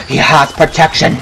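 normal talking voice

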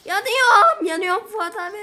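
high, loud yell for help; panicked yell. Real, not acted. Gamer on fire calls Fuat abi, loud panicked yell. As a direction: shout it to your friend.

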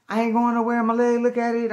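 high, loud, panicked re-enactment; acting out hurt, high-pitched pleading; frantic protest. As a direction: yell back in desperation. Hurt man protesting to police.

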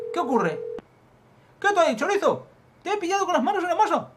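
high, loud yelling at a thief; acted furious shout. Catching a thief red-handed, high furious shout. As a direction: yell it as you catch him red-handed. Acts confronting a caught thief.

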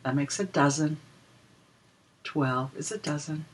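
ordinary delivery

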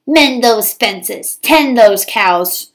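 slow, drawn-out bossy stepmother bark; stepmother yelling orders. A narrator's reading. Stepmother barking chores at Cindy, slow and bossy. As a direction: order her around like a mean rancher's wife. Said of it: "she would yell".

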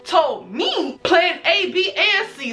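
acted high, incredulous comeback; sarcastic retort. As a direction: stretch it out in exaggerated sarcasm. Sarcastic retort to friend's blame.

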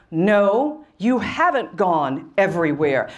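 low, measured voice of God. Retold or imitated. God correcting a young woman's prayer, voice of God. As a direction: answer as God, low and certain. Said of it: "the voice of God".

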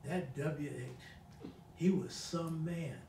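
slow, hushed, soft recollection; a quiet, slow echo of the godmother's words. Retold or imitated. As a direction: say it low and slow like a sick old woman. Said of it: "She said".

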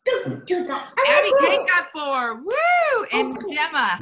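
high, loud, drawn-out cheer; excited cheer. Real, not acted. Teacher announcing a child's score, cheering excitedly. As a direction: whoop it up for the winner.

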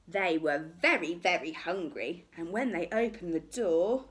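plain reading voice

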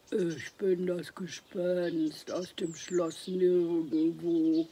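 slow, hollow, eerie ghost; a spooky ghost announcing itself slowly. A narrator's reading. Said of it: "mit hohler Stimme".

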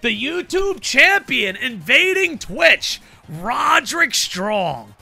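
loud, high, stretched-out introduction; ring announcer. Acted. Streamer announces champion invading Twitch, ring announcer style. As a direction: bellow the champion's entrance, slowing on the name.

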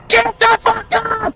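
very high, loud, stretched-out yell; furious scream. Real, not acted. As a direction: scream it slowly at your character. Screams at slow game character.

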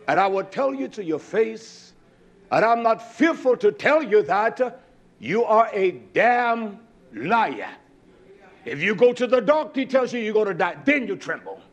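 normal talking voice

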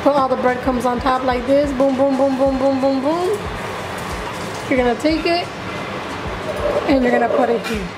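ordinary delivery

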